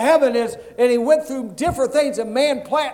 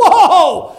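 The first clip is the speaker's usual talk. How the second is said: louder, higher, laughing whoa; mock startled; 5 semitones higher, 6 dB louder than usual. Centurion hearing that Jesus is coming, a laughing startled whoa. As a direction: react as if caught off guard.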